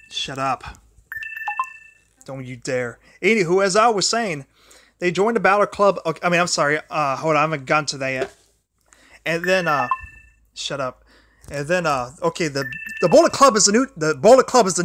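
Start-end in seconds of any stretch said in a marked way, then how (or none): 0.1-3.0 low, soft, irritated muttering; scolding a dying phone charger under his breath; annoyed mutter. Real, not acted. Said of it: "I'm getting kinda mad". 10.5-11.0 low, soft, irritated muttering; scolding a dying phone charger under his breath; annoyed mutter. Real, not acted. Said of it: "I'm getting kinda mad".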